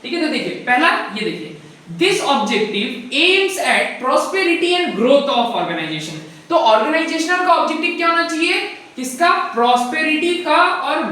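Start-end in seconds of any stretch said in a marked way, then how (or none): 2.0-6.2 very slow, word by word for note-taking; slow dictation reading of the definition. Shown as a demonstration. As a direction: spell it out slowly so students can write. Teacher reads definition for notes.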